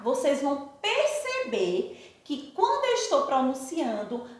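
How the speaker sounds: usual speaking voice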